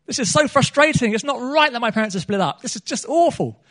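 a son shouting his frustration up at God; angry outburst at God. Retold or imitated. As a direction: pour out the anger at God, pitch up and push the volume. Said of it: "I was even angrier with God".

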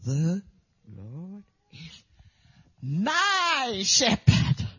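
halting, then swelling on my shepherd; boy's halting recitation. Retold or imitated. The boy reciting all day, halting then swelling. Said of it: "He would go".

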